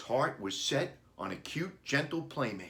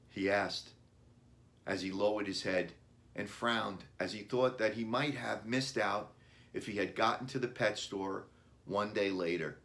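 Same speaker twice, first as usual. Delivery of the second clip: ordinary delivery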